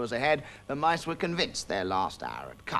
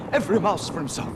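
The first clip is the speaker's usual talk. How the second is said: panicked shout; 10 semitones higher, 7 dB louder than usual. Acted.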